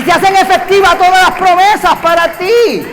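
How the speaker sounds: loud, rising preacher's cry; full-force preacher call; fiery preaching. Real, not acted. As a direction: drive each "ni una" home from the pulpit.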